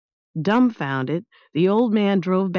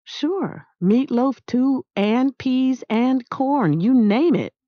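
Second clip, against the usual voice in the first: a hint of a boy's voice, higher, slow on the list; lightly voiced eager boy; 8 semitones higher, slower than usual. Boy telling old man about dinner, lightly voiced and eager. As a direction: Maniac lightly voiced, cheerfully rattling off foods. Maniac answering Grayson at dinner.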